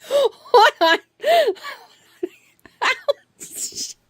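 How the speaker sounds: high, breaking into helpless laughter; laughing through pain. Real, not acted. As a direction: protest while trying not to laugh. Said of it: "I should not be laughing".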